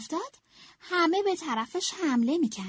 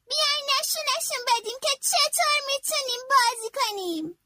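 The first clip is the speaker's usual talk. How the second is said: put-on higher, eager child voice; rally the team before the game; rallying Franklin; 10 semitones higher than usual. A narrator's reading. Franklin rallying his teammates before the final match, an eager, higher voice. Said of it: "فرانکلین گفت".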